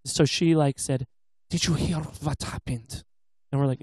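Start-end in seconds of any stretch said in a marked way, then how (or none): 1.5-3.0 imitating a thick German accent. Custodian asking the students about the news, imitated thick German accent. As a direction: put on a heavy German accent. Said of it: "she has very thick German accent".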